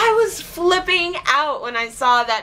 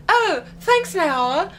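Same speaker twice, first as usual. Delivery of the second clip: higher, bright customer-service voice; chirpy company reply; 6 semitones higher than usual. Retold or imitated. Zappar account answering her on Twitter, chirpy and higher. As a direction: play the overly cheerful brand account. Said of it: "And they wrote back".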